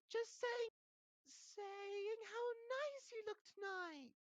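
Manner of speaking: very high, drawn-out false compliment; forced niceness. A narrator's reading. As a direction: cover up with slow, high sweetness. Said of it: "said Hermione, glaring at Peeves".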